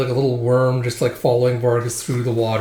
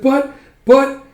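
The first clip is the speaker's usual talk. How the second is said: high, loud stammered protest; acted helpless spluttering; flustered elf; 14 semitones higher, 7 dB louder than usual. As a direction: squeak out an objection.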